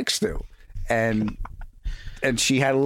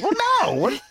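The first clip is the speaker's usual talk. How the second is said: flustered denial. Retold or imitated. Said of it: "He's like".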